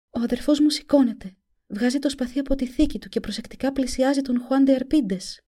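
put-on a frightened young girl seeing visions. A narrator's reading. (φωνή κοριτσιού)

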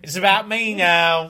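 loud, high mock-British bluster; British accent. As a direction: hijack it in a brash British accent. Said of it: "one of which is the British accent".

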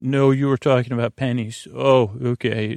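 ordinary talk, nothing marked

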